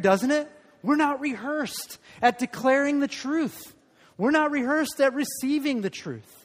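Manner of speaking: ordinary delivery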